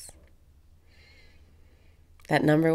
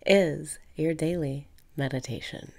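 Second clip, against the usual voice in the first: usual speaking voice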